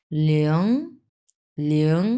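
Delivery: লিয়ং gliding lee-ong, rising at the end; held, lifting লিয়ং model; sliding pronunciation. Shown as a demonstration. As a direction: slide smoothly through লিয়ং on a rising tone. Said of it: "খুব স্লাইটভাবে উচ্চারণ করতে হবে".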